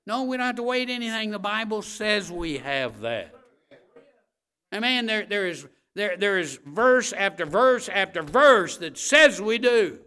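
loud, high-pitched preacher's declaration; forceful pulpit proclamation; full-force preaching. Real, not acted.

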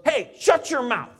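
high, loud scolding snap; sharp rebuke. Retold or imitated. Dad rebukes his cursing buddy in the car, loud and sharp. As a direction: shut him down hard, like a startled dad. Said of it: "my dad looked over at him and goes".

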